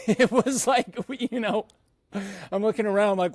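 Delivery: amused, words breaking into laughter; laughing. Real, not acted. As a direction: laugh while you talk, pitch lifting. Laughs at marriage proposal story.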